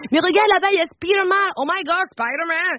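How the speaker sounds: high, gushing mum pointing out a hero; hammed-up excitement, high pitch; overexcited mother. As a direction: squeal with put-on wonder for a child. Mother excited for child at Disney.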